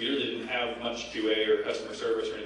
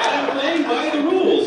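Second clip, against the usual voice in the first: high, loud retold self-defence; mock protest; 13 semitones higher, 10 dB louder than usual. Thief argues back at Lord British, loud and indignant. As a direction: insist on it, voice way up.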